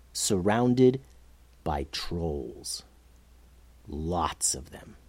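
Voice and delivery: low, very soft reveal; slow, hushed and low, letting it sink in; ominous hush. A narrator's reading. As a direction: drop right down for the reveal. Reveal of the trap, voice drops.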